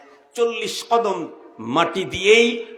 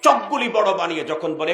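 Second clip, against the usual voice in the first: normal talking voice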